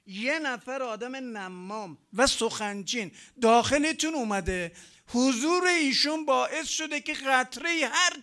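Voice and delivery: loud proclamation of a divine command; forceful preaching. Retold or imitated. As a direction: deliver the order at full force to the prophet. God's command to Moses, proclaimed. (ابلاغ فرمان با صدای بلند و کوبنده)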